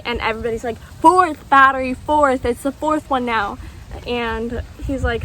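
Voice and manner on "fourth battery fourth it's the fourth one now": loud, exasperated correcting, stressing fourth; retold passenger grumbling, hammed up and louder; exasperated correction. Annoyed passengers correct the pilot about the battery, exasperated and loud.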